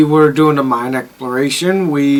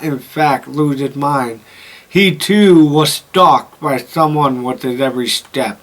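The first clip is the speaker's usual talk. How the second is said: story narration; 3 semitones lower than usual. Acted. Reads game journal aloud.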